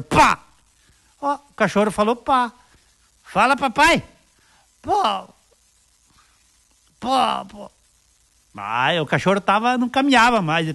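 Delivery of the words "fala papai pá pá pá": dog voice. Acted. Owner and dog's reply.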